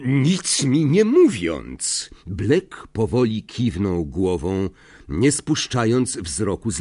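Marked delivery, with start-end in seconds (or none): none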